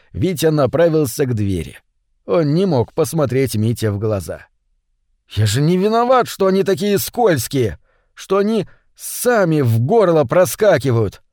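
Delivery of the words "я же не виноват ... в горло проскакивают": plaintive, higher-pitched self-defence; making excuses. Acted. Витя excuses himself for swallowing both fish, plaintive. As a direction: plead innocence in a higher voice. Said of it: "оправдывался он".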